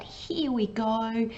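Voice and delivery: lifted, drawn-out cheer, demonstrating the buzz; excitement. Shown as a demonstration. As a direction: stretch it out like a little rallying call. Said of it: "that excitement".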